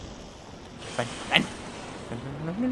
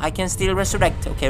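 ordinary talk

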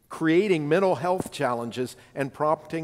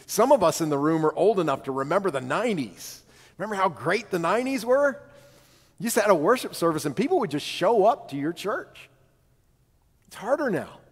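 higher, swooping nostalgic quote; animated quoted speech; 5 semitones higher than usual. Retold or imitated. Bishop reminding new ordinands of the nineties, animated. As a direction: retell it with animated, lifted pitch.

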